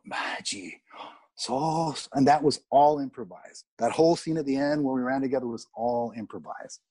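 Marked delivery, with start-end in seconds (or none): 0.0-2.1 breathy, drawn-out Maggie, then Soso; mock mystic name. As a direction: intone the name like a magic word. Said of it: "I went".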